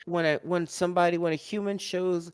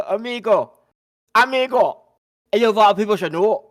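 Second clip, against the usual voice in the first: put-on higher, louder hammy Spanish accent; bad Spanish accent; 6 semitones higher, 10 dB louder than usual. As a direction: ham up a terrible Spanish accent. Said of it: "in the worst Spanish accent ever".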